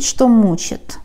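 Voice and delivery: ordinary talk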